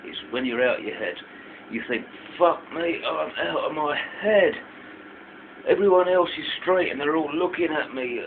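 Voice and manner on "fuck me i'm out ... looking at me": mock panicked thought, higher and louder; acted paranoia. Festival-goer imitates a first-timer losing their head, acting paranoid. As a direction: voice a scared tripper's alarm, pitched up. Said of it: "and you get scared".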